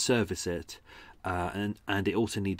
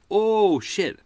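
alarmed outburst; 10 semitones higher, 7 dB louder than usual. Retold or imitated. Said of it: "And I thought".

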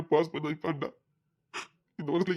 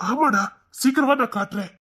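higher, louder eager hurry; excited call; 6 semitones higher, 4 dB louder than usual. Acted. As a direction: urge your friend to come quickly. Eager to show his friend.

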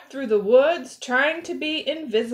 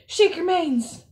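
high, lively call to join in; 7 semitones higher, 3 dB louder than usual. Real, not acted. Storyteller gets children shaking manes, calling out.

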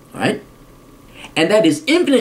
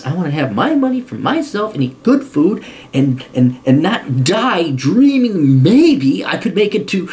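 ordinary delivery

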